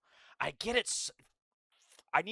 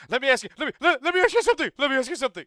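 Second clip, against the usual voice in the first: much higher, louder, stammering squeak; Michael Knowles impression; 12 semitones higher, 13 dB louder, much faster than usual. Streamer repeats his mock of Michael Knowles, loud squeaky stammer. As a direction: squeal the words out over and over. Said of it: "It's that exact same thing".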